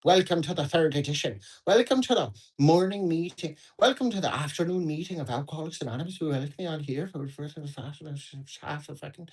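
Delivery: put-on over-the-top Irish accent welcoming everyone to the meeting; mock Irish accent. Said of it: "which I understand is a caricature of the real accent".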